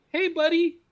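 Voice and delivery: high-pitched mock "hey, buddy"; imitated friendly greeting. Man greeting his girlfriend's boyfriend, imitated in a high voice. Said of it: "he didn't say it like that".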